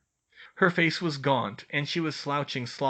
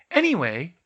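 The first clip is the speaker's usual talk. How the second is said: Hermione voice; 6 semitones higher, 7 dB louder than usual. A narrator's reading. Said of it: "she changed the subject".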